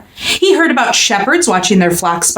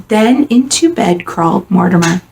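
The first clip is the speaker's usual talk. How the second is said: ordinary delivery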